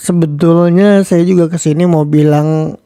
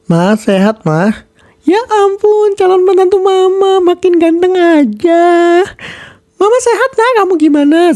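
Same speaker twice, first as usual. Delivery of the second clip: higher, gushing mother voice; doting mother; 13 semitones higher than usual. Acted. Mother greeting daughter and future son-in-law, gushing. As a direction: fuss over them like a proud mama.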